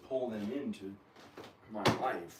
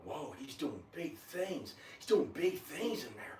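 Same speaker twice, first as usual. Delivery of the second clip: higher-pitched mocking wonder; mock awe; 5 semitones higher, 4 dB quieter than usual. Voicing lazy people in bed, mock-amazed and higher. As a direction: imitate awestruck onlookers in a put-on voice.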